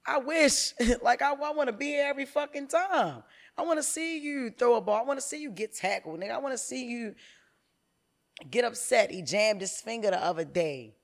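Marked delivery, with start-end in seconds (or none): 0.1-9.1 higher, louder heartfelt plea; passionate outburst. Real, not acted. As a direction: pour out how much you want to be there. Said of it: "that shit make me so mad".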